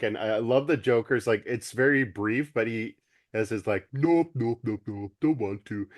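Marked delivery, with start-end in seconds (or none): 3.9-5.9 goofy cartoon dog voice; imitating the Davey and Goliath dog. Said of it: "He kinda sounded like ... the dog".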